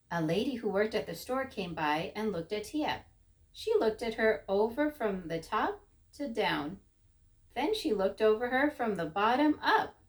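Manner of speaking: usual speaking voice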